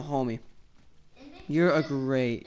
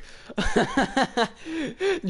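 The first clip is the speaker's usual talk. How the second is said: high laugh; 8 semitones higher than usual. Real, not acted. Laughing at drop the nuke.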